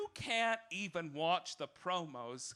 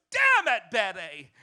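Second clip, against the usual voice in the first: diva snarl; 11 dB louder than usual. Retold or imitated. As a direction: bark it at your rival like a diva. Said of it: "Susan Sarandon goes".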